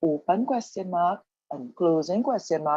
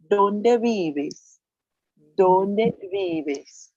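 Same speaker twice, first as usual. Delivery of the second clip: Dónde vives pitched up, weight on DÓN; model pronunciation; 3 semitones higher, 4 dB louder, much slower than usual. Shown as a demonstration. Teacher modelling the question for learners, Dónde stressed on DÓN. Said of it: "So the question would be".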